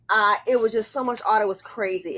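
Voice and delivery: ordinary talk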